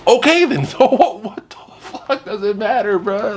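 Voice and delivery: high, laughing outburst of disbelief. Real, not acted. Laughing disbelief at wife's complaint.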